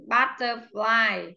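Butterfly drawn out slowly for the class; slow classroom demo of Butterfly; slow model word. Shown as a demonstration. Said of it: "teacher models word stress".

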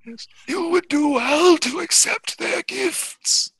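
very high, slow eerie character voice; otherworldly high voice, words stretched; Barmy. Acted. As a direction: intone it high and drawn out. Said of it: "Barmy says".

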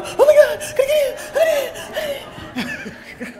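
high, loud panicked laugh; hammed-up rush panic; mock panic. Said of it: "I know you're all going".

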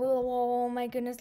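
ordinary delivery

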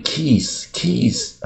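"kiss" with a long, tense ee, like "keess"; Taiwanese-style "kiss". Shown as a demonstration. Teacher mimicking how local learners say it, long-ee "kiss". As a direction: stretch the vowel of "kiss" into a drawn-out ee. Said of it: "我们一般一定念".

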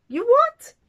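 very high, loud shocked yelp; loud high incredulous squawk; indignant ringmaster. A narrator's reading. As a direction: blurt it in stunned disbelief. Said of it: "frowned the ringmaster".

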